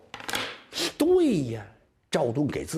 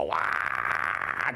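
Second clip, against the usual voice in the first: a long mock sob; hammed-up crying wail; 11 semitones lower than usual. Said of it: "这哭的".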